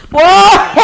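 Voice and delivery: a high, loud whoa that breaks into laughter; loud laughing whoa. Real, not acted. Host cracking up at her own people-pleasing story, laughing loudly. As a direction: burst out laughing at yourself.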